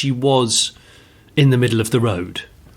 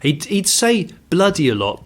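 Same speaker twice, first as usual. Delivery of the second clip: normal talking voice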